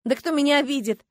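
indignant high retort; возмущённо. A narrator's reading. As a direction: bristle at the idea. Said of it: "возмутилась я в ответ". (возмущённо, с вызовом)

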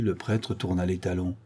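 ordinary delivery

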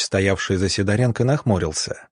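ordinary delivery

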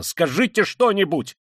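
high, loud exasperated demand; sudden outcry. A narrator's reading. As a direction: cry out, demanding a response. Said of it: "вскрикнул он вдруг совсем неожиданно".

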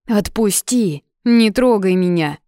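loud, annoyed pulling away; irritated outburst. A narrator's reading. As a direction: snap at him to let go. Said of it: "Раздражённо вырывается Глазкова".

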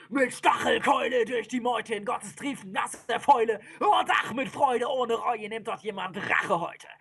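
high, driving recital; excited, high-pitched storytelling; frenzied battle narration. A narrator's reading. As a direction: tell the slaughter with mounting frenzy.

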